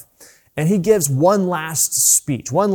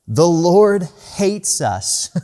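slow, drawn-out whining complaint; mock fearful Israelites; 4 semitones higher, 4 dB louder, much slower than usual. Preacher mimics the grumbling people, slow and drawn out. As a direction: drag it out like a sulking crowd. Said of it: "That's their response".

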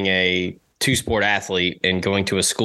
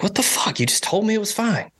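shocked disbelief; 11 semitones higher than usual. Retold or imitated. His own shocked reply.

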